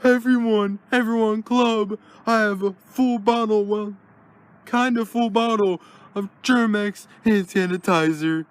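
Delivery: high, loud calling out to everyone; Ted shouting his hand-sanitizer offer to the club; loud announcement. Acted. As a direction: broadcast it to the whole club. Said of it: "I'm gonna have to make a special announcement".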